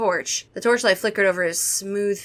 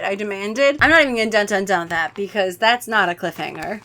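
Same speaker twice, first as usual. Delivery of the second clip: plain reading voice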